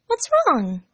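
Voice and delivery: put-on high girlish concern; concerned Amy. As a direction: ask gently as a worried friend.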